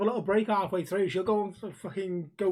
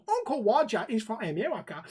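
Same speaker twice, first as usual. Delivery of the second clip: put-on Uncle Roger accent; 3 semitones higher than usual. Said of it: "the guy that does Uncle Roger".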